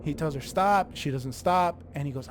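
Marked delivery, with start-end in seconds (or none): 0.4-0.9 loud, higher one-word order; annoyed command. Retold or imitated. Annoyed husband telling his wife to stop, loud. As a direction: retell it as a raised-voice halt.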